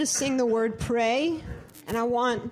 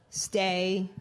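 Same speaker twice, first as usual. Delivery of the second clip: usual speaking voice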